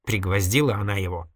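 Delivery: usual speaking voice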